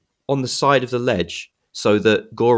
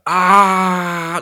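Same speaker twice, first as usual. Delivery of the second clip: high, loud falling yell; falling scream; 10 semitones higher, 5 dB louder than usual. Retold or imitated. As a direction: yell as if dropping off a cliff. Goro falling off the ledge.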